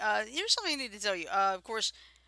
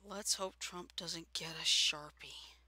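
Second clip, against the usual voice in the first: whisper; 5 semitones lower, 7 dB quieter, slower than usual. Pretend. Conspiratorial aside about Trump.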